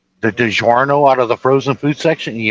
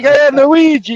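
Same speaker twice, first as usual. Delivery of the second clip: loud, much higher hammed-up Italian accent; 6 dB louder, slower than usual. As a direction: call out to Luigi in a big mock Italian accent. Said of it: "I start doing the Italian accent".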